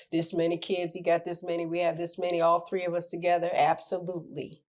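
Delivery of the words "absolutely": ordinary talk, nothing marked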